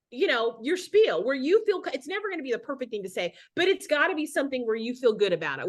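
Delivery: ordinary delivery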